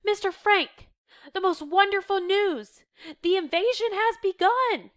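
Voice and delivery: high, loud, thrilled announcement; excited. A narrator's reading. Reader voicing a helper bringing invasion news, excited. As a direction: burst out with wonderful news. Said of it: "Meep's excited voice".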